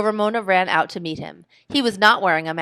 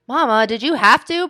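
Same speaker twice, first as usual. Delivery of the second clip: higher, louder whiny protest; 5 semitones higher, 7 dB louder than usual. A narrator's reading. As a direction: object like a put-out child. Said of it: "protested Ramona".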